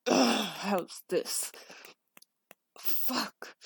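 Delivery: slow, loud, strained groaning words; straining. Acted. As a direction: push the words out through effort. Strains while thrusting harder.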